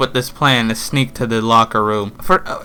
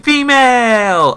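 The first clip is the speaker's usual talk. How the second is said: a high, slow drawn-out call through the door; mother yelling a name; 10 semitones higher, much slower than usual. Retold or imitated. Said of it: "she yells her name".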